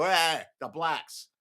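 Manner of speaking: slow, drawn-out dumb voice; mock bigot voice. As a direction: sneer it like a clueless bigot. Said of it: "they go".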